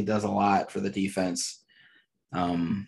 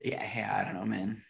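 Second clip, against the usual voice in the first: whisper; 7 dB quieter than usual. Real, not acted. Muttered aside.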